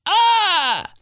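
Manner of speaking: sudden high yelp; high mock shriek. Mock burp cry.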